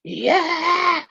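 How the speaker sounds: a loud, high yelled yeah; demonstrating a yell at the kids; loud demo. Shown as a demonstration. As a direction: show how loud it gets. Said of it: "Loud like that".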